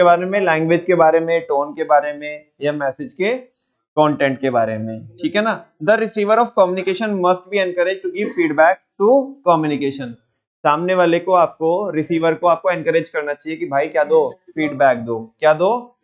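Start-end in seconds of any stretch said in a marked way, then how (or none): 5.8-10.1 each word laid out slowly for notes; dictating the point to students, slow and deliberate; slow dictation. Shown as a demonstration. Teacher dictating a note to the class, very slow.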